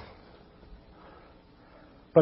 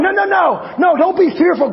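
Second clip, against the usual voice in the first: high, loud pleading no no no; urgent pleading shout; 11 semitones higher, 4 dB louder than usual. Retold or imitated. Caleb urging the frightened people, high pleading shout. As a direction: cry out to calm a frightened crowd.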